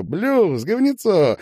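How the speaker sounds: loud, high-pitched begging; pleading. A narrator's reading. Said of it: "просительно тряс его Стасик".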